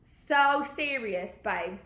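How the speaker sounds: terrible mock-British, slowly drawn out; badly faked British accent, drawn-out start. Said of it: "worst British accent I've actually ever done".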